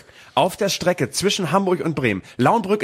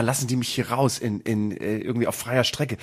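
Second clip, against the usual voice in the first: usual speaking voice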